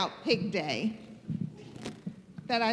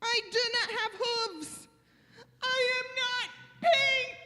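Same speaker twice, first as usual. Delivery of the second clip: high, plaintive protest; upset Gerald; 7 semitones higher, 3 dB quieter than usual. Acted. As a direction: lament it in a raised, wounded voice. Gerald upset at being left out.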